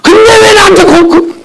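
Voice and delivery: choked cry that cracks on the repeated 고-; wailing cry. Retold or imitated. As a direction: sob it out, stammering through the tears. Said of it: "막 울부짖었어요".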